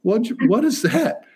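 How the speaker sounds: hammed-up flustered director stopping the take; Mel Brooks. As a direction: director rushing onto set, high and alarmed. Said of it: "Mel Brooks came running out going".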